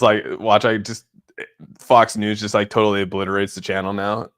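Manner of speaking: ordinary talk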